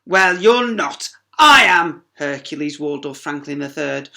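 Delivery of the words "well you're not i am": loud, slow and haughty; pompous boast. Acted. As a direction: lord it over her. Hercules boasts.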